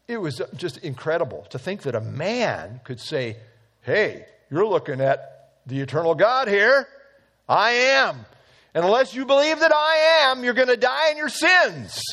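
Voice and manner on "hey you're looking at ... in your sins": bold declaration. Retold or imitated. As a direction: build to a ringing claim of divinity. Jesus voiced as a bold claim.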